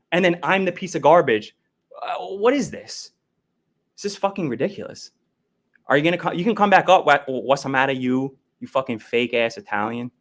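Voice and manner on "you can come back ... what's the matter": ordinary talk, nothing marked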